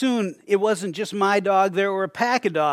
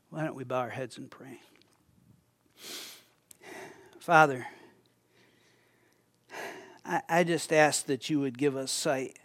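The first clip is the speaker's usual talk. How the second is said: closing prayer; 2 semitones lower, 5 dB quieter than usual. Real, not acted. Pastor leading the congregation in closing prayer, hushed. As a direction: lead the room gently into prayer.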